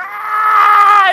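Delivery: sudden high shriek, loud; mock terrified scream; imitated scream. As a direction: shriek like you're terrified on the drop. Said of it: "she's like".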